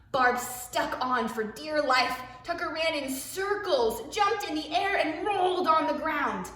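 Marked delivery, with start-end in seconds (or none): 2.4-6.5 bouncy excited storytelling; excited rush. A narrator's reading. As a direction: ride the thrill of the chase. Wild ride narrated excitedly.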